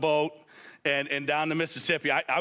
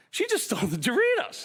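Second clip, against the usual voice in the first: high, hushed disbelief as an inner thought; retelling a stunned thought at the checkout; shocked aside; 10 semitones higher than usual. Retold or imitated. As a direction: mouth it like you can't believe what you just saw. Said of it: "I'm like".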